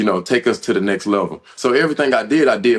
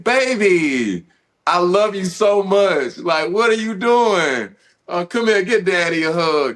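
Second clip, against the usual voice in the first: tender to daughter; 8 semitones higher, slower than usual. Retold or imitated. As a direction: coo to his little girl like a gentle dad. Father comforting his young daughter.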